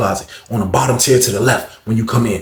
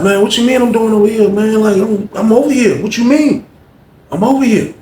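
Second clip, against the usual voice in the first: defensive; 10 semitones higher, 4 dB louder than usual. Retold or imitated. Grandson defensive, pitched up.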